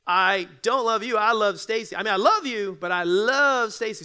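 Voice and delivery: loud, punching don't and love; emphatic. Shown as a demonstration. Explaining to the congregation, loud and emphatic. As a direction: drive it home loudly. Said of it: "In other words".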